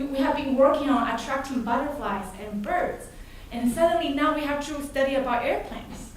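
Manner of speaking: usual speaking voice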